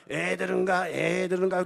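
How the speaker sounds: loud, droning mock hawker's cry; snake-oil peddler. Boy mimics a street peddler shooing kids, loud hawker voice. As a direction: call it out like a market huckster. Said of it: "목소리가 똑같잖아".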